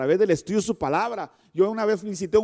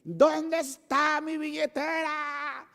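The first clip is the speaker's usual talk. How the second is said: mock frantic son; 9 semitones higher, 5 dB quieter, slower than usual. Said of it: "empiezas en la mañana a decir".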